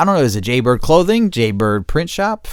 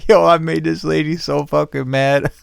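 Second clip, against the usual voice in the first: laughing; 6 semitones higher than usual. Real, not acted. Laughing retelling the call.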